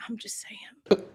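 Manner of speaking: soft unvoiced whisper; whispered aside. Real, not acted. Teasing friends about free trials, whispered aside. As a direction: whisper it like a sly joke. Joking aside after asking for cards.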